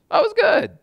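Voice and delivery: squeaky high, breezy brush-off; high, breezy "it was good"; mock chirpy reply. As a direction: toss it off in a high chipper voice. Mock reply ending conversation.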